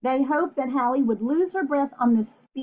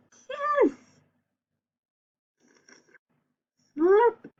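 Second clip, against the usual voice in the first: slurpy kiss sound; 10 semitones higher than usual. Acted. Dog kiss sound effect.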